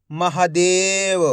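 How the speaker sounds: Mahadev stretched out slowly as one word; slow blended reading of Mahadev. Shown as a demonstration. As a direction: draw out Mahadev slowly, joining the syllables. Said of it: "एक एक अक्षर का उच्चारण करके".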